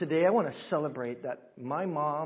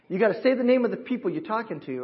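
ordinary delivery

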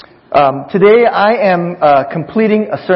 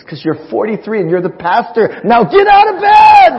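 exasperated mom shout; 7 semitones higher, 6 dB louder than usual. Retold or imitated. Punchline, mom's exasperated order.

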